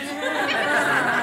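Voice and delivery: high airy laugh; breathy laugh. Real, not acted. Speaker laughs off tears before audience. Laughs after crying on cue.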